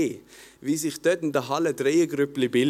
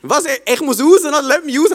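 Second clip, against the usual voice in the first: excited, loud demand; 7 semitones higher, 6 dB louder than usual. Retold or imitated. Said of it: "Und ich so".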